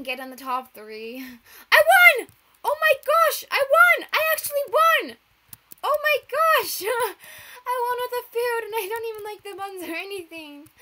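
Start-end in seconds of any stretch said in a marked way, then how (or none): 1.7-10.7 high, very loud joyful shrieks; shrieking jackpot winner. Real, not acted. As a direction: scream with delight at winning. Learns she won.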